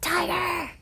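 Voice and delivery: high, mock roaring tiger; tiger cry. Mouse turned tiger, a light mock roar. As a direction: announce the tiger with a playful roar.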